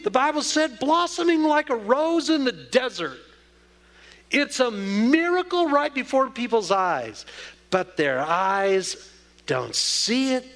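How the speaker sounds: full-force sermon delivery; preaching. Acted. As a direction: preach it out to the congregation.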